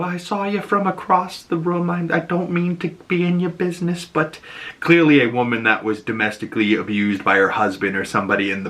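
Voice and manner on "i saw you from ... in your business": a lightly voiced, higher, hushed stranger butting in; concerned stranger. Pharmacy stranger gently approaching Harcourt, lightly voiced and hushed. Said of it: "a woman comes over to her and starts like".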